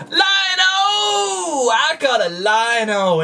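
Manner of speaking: high delighted shriek; excited squeal. Real, not acted. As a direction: squeal with real toy joy. Excited unboxing of toy.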